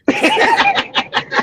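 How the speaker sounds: high giggle after the punchline; real laughter. Real, not acted. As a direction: crack up at your own tale.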